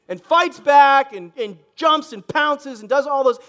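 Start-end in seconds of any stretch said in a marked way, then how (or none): none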